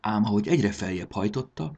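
ordinary delivery

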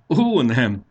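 high, loud, amused denial. Acted. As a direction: brush it off with acted amusement. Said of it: "nevetett Marci". (derülve, nevetősen)

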